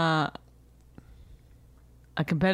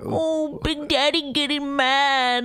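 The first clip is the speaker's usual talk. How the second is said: high, drawn-out mock alarm; 9 semitones higher, much slower than usual. Wife taunting husband for getting mad, exaggerated mockery. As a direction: tease with slow, high-pitched oohs.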